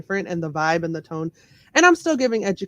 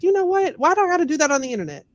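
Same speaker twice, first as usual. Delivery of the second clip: higher-pitched questioning of herself; retold exasperation; 10 semitones higher, faster than usual. Recalling her decision to stop masking her accent, exasperated. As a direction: recount a fed-up turning point, pitched up. Retelling her own fed-up realization.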